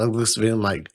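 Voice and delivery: ordinary talk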